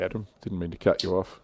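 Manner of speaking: usual speaking voice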